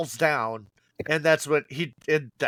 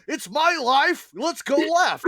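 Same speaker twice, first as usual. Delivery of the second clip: mocking Larry; 10 semitones higher than usual.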